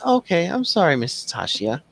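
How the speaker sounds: put-on high, sweet little-girl voice. Little girl inviting Tasha to her tea party, high and sweet. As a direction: ask politely like a tiny child. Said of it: "She says".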